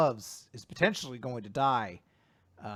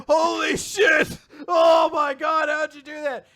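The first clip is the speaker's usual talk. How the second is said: amazed shout; 13 semitones higher, 13 dB louder than usual. Retold or imitated. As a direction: cheer like the crowd lost it. Everyone amazed at the kill.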